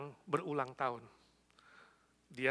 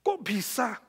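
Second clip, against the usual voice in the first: astonished wife, high and loud; kaget; 9 semitones higher, 8 dB louder, slower than usual. Retold or imitated. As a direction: act out a startled, disbelieving reply. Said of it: "Hah, kaget istrinya". (istri kaget, nada meninggi)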